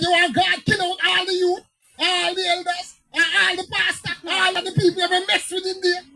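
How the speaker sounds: high and loud, pressing the challenge; fired-up pastor confronting the elders; retold heated outburst. Retold argument with the president.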